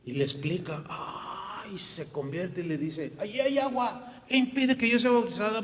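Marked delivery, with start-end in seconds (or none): 3.2-5.6 high, hopeful request; eager convert. Acted. The new convert asking to be baptized, high and eager. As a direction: ask keenly, almost pleading.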